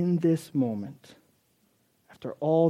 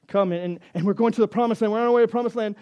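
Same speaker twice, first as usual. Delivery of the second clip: raised, louder, building eagerness; rally everyone onward with rising energy; eager announcement; 7 semitones higher, 6 dB louder, faster than usual. Acted. Preacher voices Moses leading people to promised land, eager.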